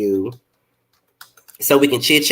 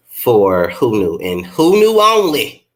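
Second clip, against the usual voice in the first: "Who Knew" slow and loud; stress on Who Knew only; 6 dB louder, slower than usual. Real, not acted. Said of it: "Nobody else, but Who Knew".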